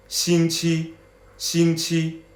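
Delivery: steady 星期, same length and strength; 星期 drilled evenly; even first tones. Shown as a demonstration. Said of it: "both first tones".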